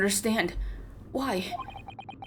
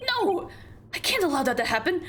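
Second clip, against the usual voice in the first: defiant cry rising on can't; indignant shout; 8 semitones higher than usual. Acted. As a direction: burst out refusing. Indignant outburst read aloud.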